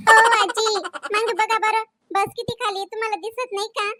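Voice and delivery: put-on snippy young woman brushing off the auntie; young bus passenger. Same speaker voices young woman.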